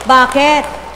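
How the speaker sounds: high, slow, loud call to the crowd; preacher's rallying cry. Real, not acted. Preacher rousing the congregation, loud and slow. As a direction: belt it out to stir the faithful.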